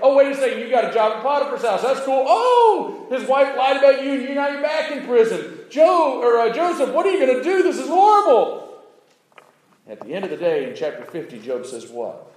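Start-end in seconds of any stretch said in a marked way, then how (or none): 0.0-8.8 high, loud, theatrical cries of woe and relief; mock dramatic exclamations. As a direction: ham up each twist of Joseph's story. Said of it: "My brothers threw me in a pit".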